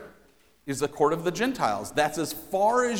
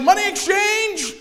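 high, loud barker shouting fast; carnival barker shout; 12 semitones higher, 7 dB louder than usual. Retold or imitated. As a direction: yell it like a money changer at a fair. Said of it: "Maybe he's a carnival barker".

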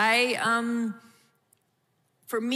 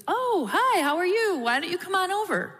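high, bright sing-song welcome, hammed up; mock chirpy husband inviting; 11 semitones higher than usual. Wife imitating her husband eagerly inviting church guests over, high sing-song. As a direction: gush over new church visitors like an eager host. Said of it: "and he would be".

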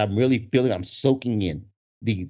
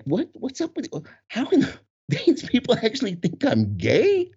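high-pitched, stammering disbelief; mock incredulity; 9 semitones higher than usual. Dancer baffled by crowd's homophobic names, high incredulity. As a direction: stumble upward into amused disbelief.

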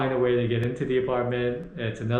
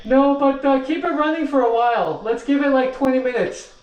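raised, higher voice calling out; yelling across the room; 11 semitones higher, 5 dB louder than usual. Real, not acted. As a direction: call it up to the next floor. Calling instructions to another floor.

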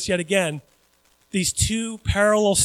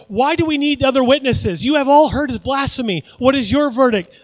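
raised, outraged accusing voice; upset high priest; 5 semitones higher, 4 dB louder than usual. A narrator's reading. High priest to the council, upset and indignant. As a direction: demand a verdict from the crowd. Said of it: "very upset".

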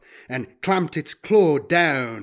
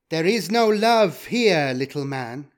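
called out; 4 semitones higher, 3 dB louder than usual. A narrator's reading. Cultist woman mocking the data trader from afar, taunting call. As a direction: throw it across the smoke at him. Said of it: "a woman's voice called out".

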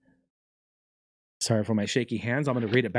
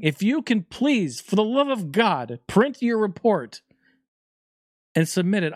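slow, high pleading teacher; 6 semitones higher than usual. Retold or imitated. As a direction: beg them to print it, slowly. Pleading teacher voiced in story.

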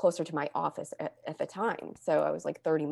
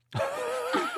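high laugh. Real, not acted. Laughing at her own joke to the podcast host.

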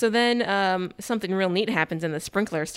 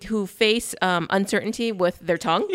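ordinary delivery

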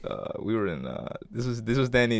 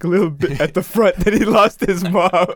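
louder, rising mockery; teasing laugh-talk; 6 semitones higher, 9 dB louder than usual. Real, not acted. Friend mocking Danny crying at sixteen, loud teasing. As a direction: rib him loudly while laughing.

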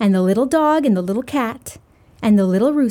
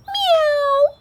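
cat meow. Acted. Cat answers in story.

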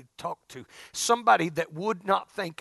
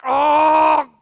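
1 loud groan-like oh; 7 semitones higher, 10 dB louder than usual. Retold or imitated. Dreading phone call.

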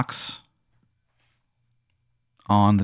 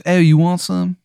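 put-on terrible Maryland accent; 7 semitones higher than usual. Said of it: "His accent is so bad".

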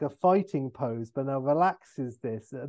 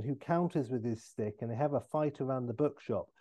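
ordinary talk, nothing marked

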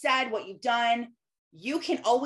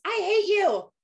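imitating a kid's yell; 10 semitones higher, 4 dB louder, slower than usual. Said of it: "If my kid yells".

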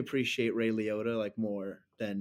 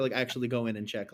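ordinary talk, nothing marked

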